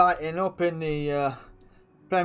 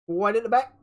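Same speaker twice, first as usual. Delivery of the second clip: higher, louder mock catchphrase call; quoted catchphrase; 7 semitones higher, 5 dB louder than usual. Streamer recalling who said "at the back", imitated catchphrase. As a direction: call it out like someone else's old catchphrase. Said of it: "Who, who used to say that?".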